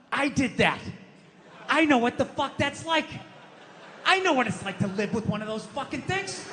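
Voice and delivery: normal talking voice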